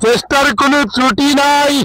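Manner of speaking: comic cricket commentary. Acted. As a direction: ham up the commentary for laughs. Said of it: "দর্শককে হাসিয়েছেন". (মজার ধারাভাষ্য)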